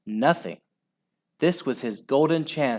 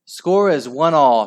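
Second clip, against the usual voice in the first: higher, loud announcement; referee's call; 4 semitones higher, 7 dB louder than usual. A narrator's reading. Referee announcing the score, calling out. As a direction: call it out to the players.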